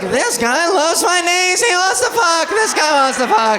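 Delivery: Seinfeld. Acted. Comic thrilled by her catcaller, excited sitcom-star voice. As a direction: stretch each word in a high excited whine. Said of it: "I fucking sound like Seinfeld".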